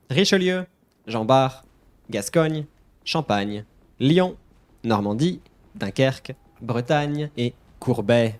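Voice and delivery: ship names in French, from "Richelieu" to "Courbet"; French pronunciation recap. Shown as a demonstration. Teacher running back through the whole lesson, French pronunciation from "Richelieu" to "Courbet". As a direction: list "Gascogne", "Champagne", "Dunkerque" crisply in French. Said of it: "time for your lesson".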